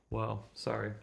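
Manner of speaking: usual speaking voice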